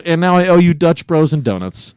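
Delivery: ordinary talk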